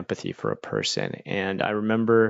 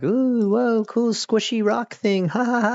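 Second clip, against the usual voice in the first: high, slow, wide-eyed wonder at a toy; mock-dazzled shopper, up high and drawn out; awed excited gasp; 12 semitones higher, 3 dB louder than usual. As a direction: gush over a squishy rock like a kid. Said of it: "You're kinda like".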